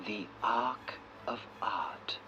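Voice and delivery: a long, hushed whisper; slow whisper. Acted. Guide whispering the grand exhibit's name to visitors. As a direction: breathe the name out slowly like a secret.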